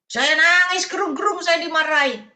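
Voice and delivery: high, loud imitation of an upset member; retold mock wail. Church member telling the preacher of being scolded, exaggerated outcry. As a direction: play up the complaint, pitched high and pushed out.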